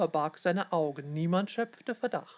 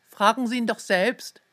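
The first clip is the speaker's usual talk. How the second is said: louder, higher angry snap; hissed retort, much louder; snapping back; 4 semitones higher, 7 dB louder than usual. Acted. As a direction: snap at the nosy man to back off. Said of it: "fauchte Ted zurück".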